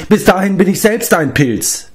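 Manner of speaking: high-pitched, miserable wail; moaning complaint. A narrator's reading. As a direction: lament it with a groan. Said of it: "stöhnte Glev". (stöhnend klagend)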